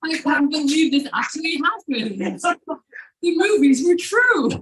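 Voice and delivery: high, loud, giddy disbelief; totally thrilled. Retold or imitated. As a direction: squeal it like a dream come true.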